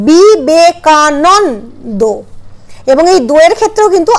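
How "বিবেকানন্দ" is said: Vivekananda drawn out syllable by syllable, very slow; slow demonstration. Shown as a demonstration. Teacher showing students how বিবেকানন্দ is said, very slow. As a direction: spell Vivekananda out slowly with a clear নন. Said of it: "উচ্চারণটা হচ্ছে নন".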